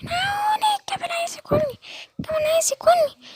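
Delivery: very high, crying squawks for help; a hen running for her life, screaming; shrieking chicken begging the animals for rescue; panicked chicken. Acted. Chicken fleeing the camel.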